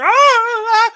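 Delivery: high, loud mock wails of "ah"; imitating hollering. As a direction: wail like people dying in their houses. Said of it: "You just start hearing people hollering".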